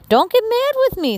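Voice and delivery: Molly's voice. Acted. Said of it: "said Molly".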